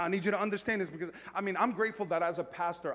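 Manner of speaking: usual speaking voice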